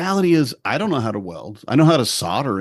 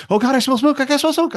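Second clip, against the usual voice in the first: very high, louder panicked voice; hammed-up freak-out over smoke; mock panic; 4 dB louder than usual. College kid smelling burning dust, panicking.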